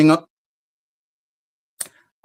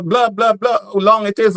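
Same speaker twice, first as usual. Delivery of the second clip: ordinary talk, nothing marked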